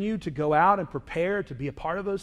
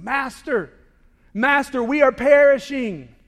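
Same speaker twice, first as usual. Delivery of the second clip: panicked plea; 4 semitones higher, 5 dB louder than usual. Acted. Disciples crying out in storm.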